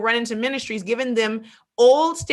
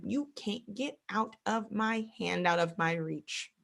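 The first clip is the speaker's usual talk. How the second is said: much softer, slow warning; God softly warning those who flee; quiet divine warning; 11 dB quieter, slower than usual. Retold or imitated. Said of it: "I am".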